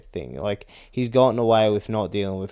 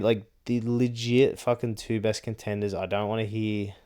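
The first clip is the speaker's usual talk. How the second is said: ordinary talk, nothing marked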